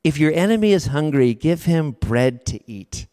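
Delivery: ordinary delivery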